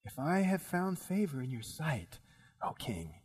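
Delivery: soft, deferential opening of a plea; humble plea. Acted. Queen humbly petitioning the king, soft and deferential.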